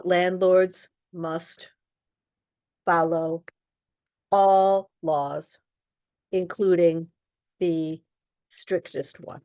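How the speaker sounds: slow, deliberate, word by word; slow and emphatic. Shown as a demonstration. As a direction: spell out each word slowly for students. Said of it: "Answer is A".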